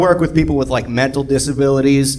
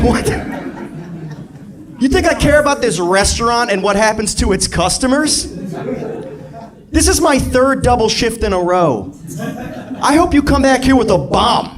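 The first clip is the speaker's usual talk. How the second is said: high-pitched, worked-up comic rant; acted exasperated rant; 8 semitones higher than usual. Overworked waiter at customer, acted exasperated outburst.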